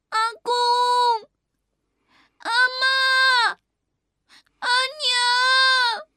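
put-on high, wailing child's shout; loud calling out. A narrator's reading. Child calling vanished family, loud high drawn-out shout. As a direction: cry out loudly, stretching each name. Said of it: "资娘仔的大声呼叫".

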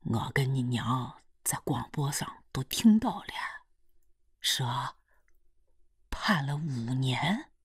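low-key dialect father, slowing at the end; subdued village father. Acted. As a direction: break the bad news haltingly, in dialect. Father tells what he heard.

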